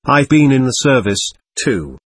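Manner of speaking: plain reading voice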